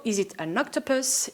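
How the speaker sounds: ordinary delivery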